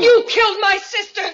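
high, loud accusing cry; wife shouting the accusation at her husband; angry accusation. Acted. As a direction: fling the blame in his face. Ruth accusing Frank.

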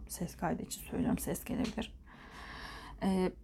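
hushed aside whisper. Real, not acted. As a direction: drop to a quick whisper. Aside about the recording.

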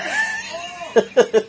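high, bursting laugh. Real, not acted. Big laugh at wrestling fail.

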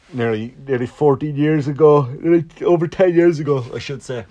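talking through a yawn; words stretched by a yawn; mid-yawn speech. Real, not acted. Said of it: "I'm sorry for yawning".

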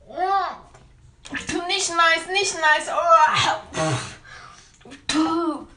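gagging between words; retching, struggling to speak; dry heaving. Real, not acted. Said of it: "ich muss würgen".

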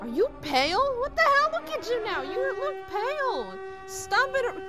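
high-pitched, agitated fussing; alarmed scolding. Acted. Annika alarmed at friend's paleness.

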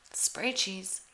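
ordinary talk

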